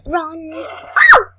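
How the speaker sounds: urgent loud call to run; panicked shout. Real, not acted. Player shouting to run from a monster. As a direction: yell at yourself to flee.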